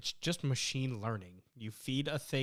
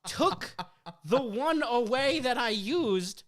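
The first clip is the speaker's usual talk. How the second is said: high, loud, incredulous mock fury; hammed-up outrage with long pauses between bursts; outraged rant; 10 semitones higher, 7 dB louder, slower than usual. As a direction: rant word by word in a raised, loud voice. Acted outrage at the phone assistant.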